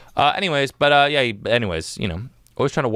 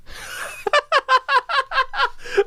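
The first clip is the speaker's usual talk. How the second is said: high burst of laughter; cracking up at himself; real laugh. Real, not acted. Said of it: "dude, I sound like a freaking".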